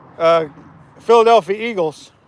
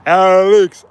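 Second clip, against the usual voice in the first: calling out; 8 dB louder than usual. Real, not acted. Calling out to his fishing buddy.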